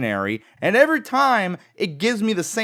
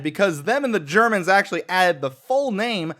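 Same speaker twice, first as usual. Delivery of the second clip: usual speaking voice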